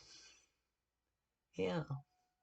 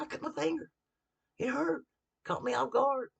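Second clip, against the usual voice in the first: mock hurt yelp; 11 semitones higher than usual. Acting himself cutting finger.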